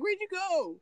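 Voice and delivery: very high-pitched mock call after someone; retold calling out. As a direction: imitate calling after a runaway friend. Retells calling after fleeing friend.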